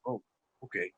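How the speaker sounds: soft, deflated acknowledgement; gone quiet, bummed out. Retold or imitated. Bandmate taking a rebuke in front of everyone, quiet and deflated. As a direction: imitate someone going quiet and crestfallen. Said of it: "he got really quiet".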